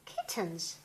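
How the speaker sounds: usual speaking voice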